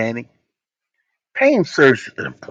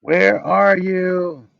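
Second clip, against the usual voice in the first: loud, higher, stretched where are you; yelled question; 7 semitones higher, 5 dB louder, much slower than usual. Shown as a demonstration. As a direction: call it out across a field. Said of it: "I yelled it to y'all".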